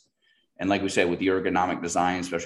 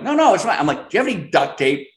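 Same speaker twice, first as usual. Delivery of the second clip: eager reassurance; 11 semitones higher, 9 dB louder than usual. Retold or imitated. Reassuring friend over broken plant.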